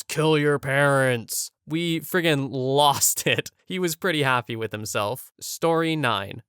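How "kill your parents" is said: drawn-out mock metal yell; sudden yell. A narrator's reading. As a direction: yell it slowly, like a metal vocalist. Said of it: "out of nowhere he yells".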